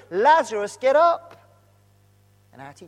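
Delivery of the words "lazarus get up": loud, rising shouted command. Retold or imitated. Jesus shouts at the grave for Lazarus, loud and commanding. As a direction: call him out of the tomb, full voice. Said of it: "he shouts at the grave".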